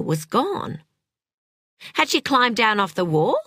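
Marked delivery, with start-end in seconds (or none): none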